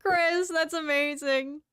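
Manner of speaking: high, slow, coming out of a laugh; amused delight. Real, not acted. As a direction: still tickled, voice high from laughing. Reacting after laughing at chat.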